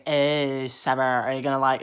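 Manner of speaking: lightly voiced relative, prodding; mock family member. Relative pestering him about his hair, mock nagging.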